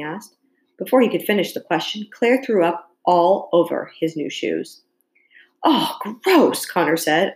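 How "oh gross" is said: high, amused disgust; suppressed laugh. A narrator's reading. Connor reacting to Claire throwing up, trying not to laugh. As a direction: say it high, holding back a laugh. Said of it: "obviously trying not to laugh".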